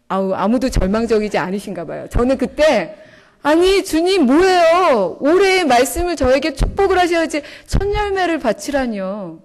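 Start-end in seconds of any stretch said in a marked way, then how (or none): 3.4-9.4 mock outraged "what is this, Lord"; indignant protest to God. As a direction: protest like a cheated child. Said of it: "이런 마음이었습니다".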